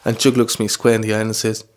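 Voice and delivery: usual speaking voice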